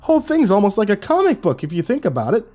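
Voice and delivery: high-pitched excited cameraman; excited rambling. A narrator's reading. Excited cameraman rambles about the creature.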